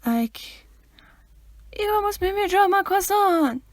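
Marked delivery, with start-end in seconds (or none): 1.8-3.6 high, put-on voice for the quote; imitating the Vine clip, high and loud. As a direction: squeak it like the Vine. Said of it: "quote Vines".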